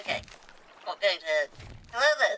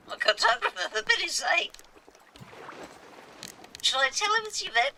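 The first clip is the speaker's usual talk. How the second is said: high-pitched cartographer; 11 semitones higher than usual. Acted.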